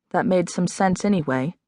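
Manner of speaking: ordinary delivery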